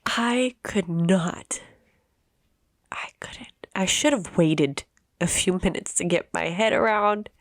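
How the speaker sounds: whispered, on the edge of tears; barely getting the words out through real grief; choked whisper. Real, not acted. Choking up retelling the call.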